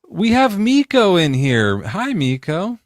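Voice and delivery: high, loud, slow welcome; excited greeting. Real, not acted. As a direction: greet a caller with delight. Greeting a caller joining live.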